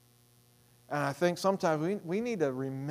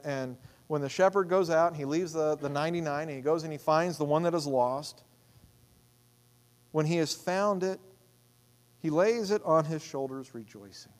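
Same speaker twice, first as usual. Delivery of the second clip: ordinary delivery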